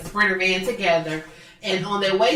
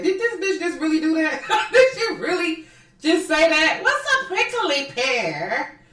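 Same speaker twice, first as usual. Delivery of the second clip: high-pitched, laughing disbelief; mock disbelief; 8 semitones higher than usual. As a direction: react like you can't believe she said it. Acted shocked reaction to the show.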